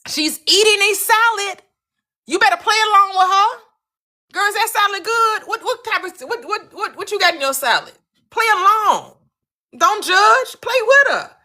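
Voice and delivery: a high hyped-up friend insisting; high excited urging. Retold or imitated. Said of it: "What did Abdullah tell him?".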